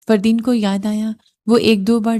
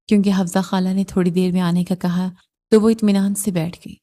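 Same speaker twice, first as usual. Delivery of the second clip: usual speaking voice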